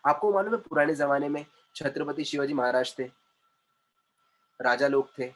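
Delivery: ordinary talk, nothing marked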